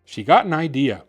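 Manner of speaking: ordinary delivery, nothing marked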